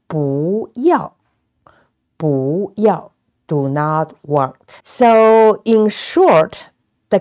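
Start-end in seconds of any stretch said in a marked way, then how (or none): 0.1-3.1 "不" rises, then "要" falls; changed second tone. Shown as a demonstration. Teacher modelling another example, "不" rising before falling "要". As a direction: lift "不", then drop "要". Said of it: "And this one".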